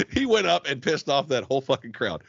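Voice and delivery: normal talking voice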